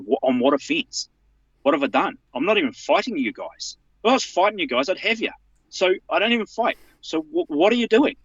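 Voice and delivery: high, rising disbelief; indignant protest. Retold or imitated. Retold protest to police officer.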